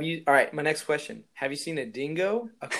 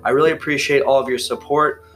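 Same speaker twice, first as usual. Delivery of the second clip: upbeat, a bit louder host read over music; podcast outro; 3 semitones lower, 10 dB louder than usual. Acted. Host signing off to listeners, upbeat outro read. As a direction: wrap up the episode for listeners.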